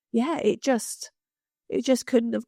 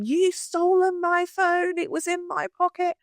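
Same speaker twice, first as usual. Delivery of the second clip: mock scream; 9 semitones higher than usual. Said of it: "this man starts screaming".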